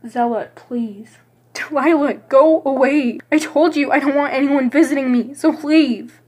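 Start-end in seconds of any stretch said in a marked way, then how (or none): 1.5-6.2 raised, sharp; loud, upset refusal; angry. Acted. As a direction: push her away with anger. Friend refuses visitor, snapping.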